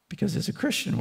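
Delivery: ordinary delivery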